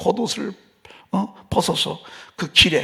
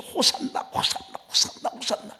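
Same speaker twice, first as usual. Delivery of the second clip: whispered hosanna; 6 semitones higher, 7 dB quieter than usual. Retold or imitated. Crowd waving palm branches.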